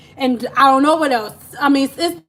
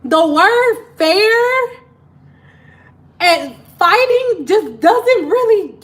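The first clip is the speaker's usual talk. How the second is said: puzzled; 7 semitones higher, 5 dB louder, slower than usual. Retold or imitated. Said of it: "I'm like".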